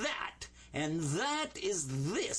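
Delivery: "that" and "this" slow with a heavy voiced th. Shown as a demonstration. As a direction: lean on the th in "that" and "this". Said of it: "give it a heavy T H sound".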